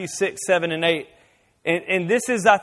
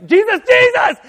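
much higher panicked scream of Jesus; screaming mom; 13 semitones higher, 12 dB louder than usual. Retold or imitated. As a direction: scream the name as the brakes slam. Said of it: "she's screaming out His name".

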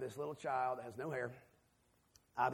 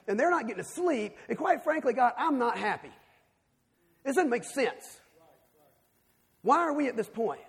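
raised, higher, louder complaint to God, building in heat; frustrated prayer; 7 semitones higher, 6 dB louder than usual. Retold or imitated. Tired parent in a hospital complaining to God, loud and angry. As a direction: pour out the grievance like a father at the end of his rope. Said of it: "I, I'm frustrated".

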